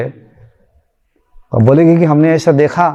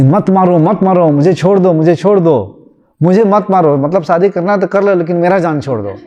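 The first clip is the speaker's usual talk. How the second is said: normal talking voice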